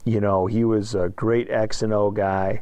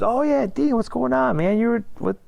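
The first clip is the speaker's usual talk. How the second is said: mock surprised greeting, pitched way up; surprised coach greeting. Cleveland State coach greeting the returning player, high mock surprise. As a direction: play the coach, startled and delighted to see him.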